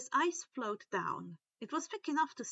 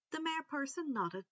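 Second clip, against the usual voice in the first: plain reading voice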